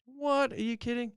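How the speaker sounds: mock astonishment. Imagined listener disbelief.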